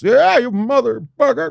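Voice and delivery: put-on high, slow, drawn-out drunken taunt; drunk heckler. Drunk partygoer taunting the comedian on stage, slurred taunt. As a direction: stretch it out like a hammered heckler.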